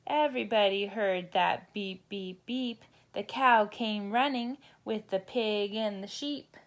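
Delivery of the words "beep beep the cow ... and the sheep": plain reading voice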